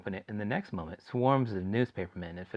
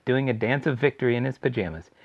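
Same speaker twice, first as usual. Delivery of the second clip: plain reading voice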